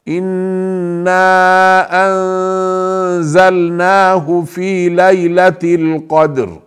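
slow recitation with the نون of أنزلناه hidden in a nasal ghunna; tajweed recitation. Shown as a demonstration. Teacher demonstrating ikhfa to the class, slow chant. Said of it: "هكذا أحبابي معنى نجهز مع الإخفاء".

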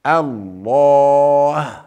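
الله with a full, heavy, long drawn alif, slow; heavy Allah. Shown as a demonstration. Teacher showing students the heavy alif of الله, slow and full. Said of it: "لفظ الجلالة لماذا نفخم فيه الألف".

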